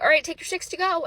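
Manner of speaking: cheery insistence. Retold or imitated. Bishop insisting playfully.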